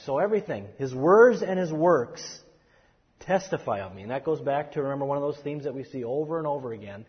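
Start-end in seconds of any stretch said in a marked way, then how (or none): 0.8-2.2 louder and slow, each word weighted; slow emphasis. Shown as a demonstration. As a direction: weigh "words" and "works" deliberately. Teacher stresses key phrase.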